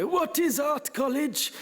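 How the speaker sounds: dad suddenly asking in an odd accent, mock-posh; dad's put-on accent. Baffled dad asking about art college in a mock-posh accent. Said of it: "don't know why he did the accent".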